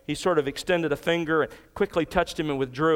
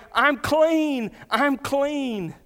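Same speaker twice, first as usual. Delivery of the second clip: joyful yell; 9 semitones higher, much slower than usual. Retold or imitated. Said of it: "he's yelling".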